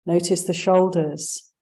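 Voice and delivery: usual speaking voice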